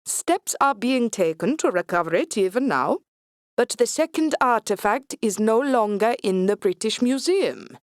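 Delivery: put-on cold, smug Swedish accent. A narrator's reading. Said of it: "Kasdan smiles thinly".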